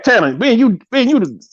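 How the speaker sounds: ordinary talk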